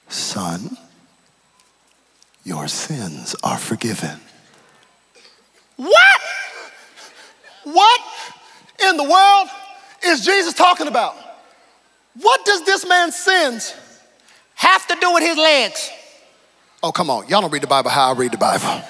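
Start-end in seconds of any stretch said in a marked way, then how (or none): 0.1-4.2 low, slow, hushed Jesus voice; a hushed tender word, drawn out slowly; Jesus, gentle and low. Retold or imitated. Jesus speaks low and gently to the lowered man. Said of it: "first thing he says". 5.8-15.9 high, loud disbelief; incredulous outburst. Retold or imitated. Preacher voices the crowd's disbelief, high and loud. Baffled outburst at Jesus' words.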